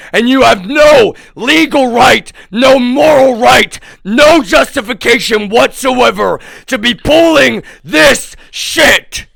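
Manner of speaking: furious defiant cry; angry shout. Real, not acted. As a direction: shout your rights at them. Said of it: "Just to let you people know".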